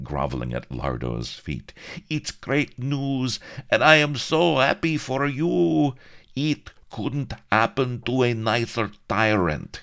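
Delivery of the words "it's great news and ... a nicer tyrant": high, oily flattering voice; fawning greaseball. A narrator's reading. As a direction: lay the flattery on thick.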